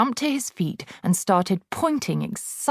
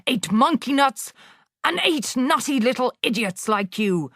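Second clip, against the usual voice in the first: ranting headmistress; 7 semitones higher than usual. Acted. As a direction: sneer and hammer every insult. Trunchbull ranting at Wilfred.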